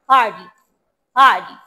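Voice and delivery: party opening on an airy, f-like p. Shown as a demonstration. Teacher corrects a student's sentence, f-like p in party. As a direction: puff the p of party almost into an f. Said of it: "ফয়ের মতো উচ্চারণ করতে হবে".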